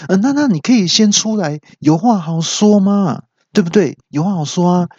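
high, pleading imitation; anxious coaxing. Security guard coaxes man out of elevator, anxious. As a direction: coax like a nervous guard.

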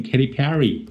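Katy Perry with PERR-y stressed in front; correct name. Shown as a demonstration. DJ repeating what the radio said, correctly pronounced. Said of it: "听I C R T念".

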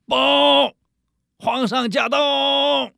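high, drawn-out herald shout; shrill palace servant. Retold or imitated. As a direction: cry it out like a court herald. Soldier announces the emperor.

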